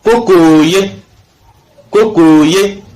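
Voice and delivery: "kokoye" with the second O drawn out; long O example. Shown as a demonstration. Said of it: "ça devient O, comme".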